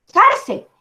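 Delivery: "cárcel" with a high CAR, dropping on cel; stress on first syllable. Shown as a demonstration. Teacher gives students an example, "cárcel" stressed high on CAR. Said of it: "toca exagerar un poco".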